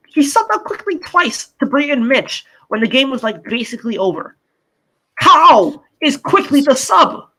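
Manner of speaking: high, incredulous outburst; exasperated rant. Real, not acted. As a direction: protest in disbelief, pitch jumping up. Exasperated rant about coach's substitution.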